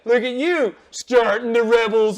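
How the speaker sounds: high, hammed-up cheering game voice; imitating a cheesy game praising you; mock game narrator.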